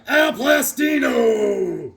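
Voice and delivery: loud, high, drawn-out name; mock announcer shout. Host announces the comic's artist, hammed-up shout. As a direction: belt it out like a ring announcer.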